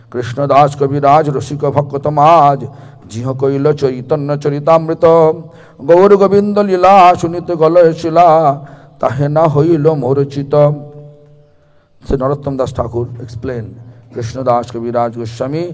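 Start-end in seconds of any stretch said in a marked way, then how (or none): 0.1-10.5 recited verse. Acted. Preacher reciting a sacred verse to the assembly, loud and chanted. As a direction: declaim the verse like a hymn to the devotees.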